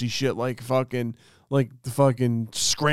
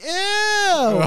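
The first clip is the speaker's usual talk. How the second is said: high, drawn-out squeal of disgust; 3 dB louder than usual. Retold or imitated. Sister reacting to the blood, disgusted squeal. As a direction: imitate a grossed-out sister shrieking.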